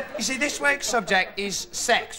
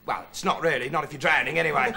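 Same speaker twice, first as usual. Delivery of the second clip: usual speaking voice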